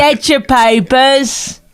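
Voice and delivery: very slow, stretched newsboy cry; newsboy call. Pretend. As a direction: drag out the hawker's call. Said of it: "Is that how they did it".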